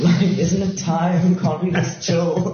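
high, slow laughing plea. Real, not acted. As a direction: laugh it out, half joking. Joking plea, laughing, pitch jumps.

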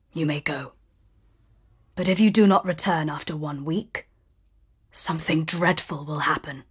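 quiet low monster voice; low beast warning. Acted. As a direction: warn her darkly. Beast warns Belle.

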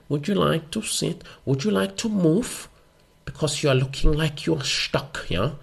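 put-on slow, high-pitched German-accented flight attendant. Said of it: "I'm not gonna do a German accent".

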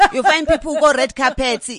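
laughing loudly while speaking; loud laughing delivery. Real, not acted. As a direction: let the laugh carry through the words. Laughing at celebrities.

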